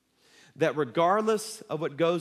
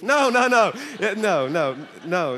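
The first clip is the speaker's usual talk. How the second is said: loud playful no; 5 dB louder than usual. Real, not acted. Preacher to laughing congregation, loud playful denial. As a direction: shoot the joke down with a laugh. Correcting the joke loudly.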